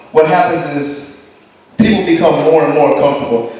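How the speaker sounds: normal talking voice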